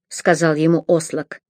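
usual speaking voice

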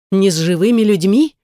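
usual speaking voice

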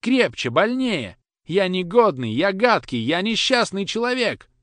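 high, loud sobbing cries; tearful outburst. Acted. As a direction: wail it out through tears. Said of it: "сквозь слёзы".

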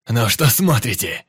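loud, raging yell at the group; furious shout. A narrator's reading. Пётр кричит на свой отряд, бешено. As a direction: bark it out, wild-eyed. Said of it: "кричал он с бешеным выражением лица".

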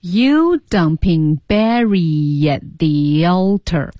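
"Barry at the altar" stretched as "Barry-yat thee-yaltar"; careful learner echo of "You dumping Barry at the altar"; slow linked repeat. Shown as a demonstration. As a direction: draw out "You" and glide through "Barry at". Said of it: "慢慢地多练习这一段".

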